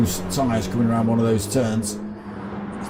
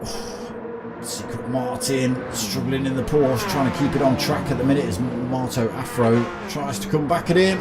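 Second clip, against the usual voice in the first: motorsport commentator; 3 semitones higher than usual. Acted. Live sim race commentary.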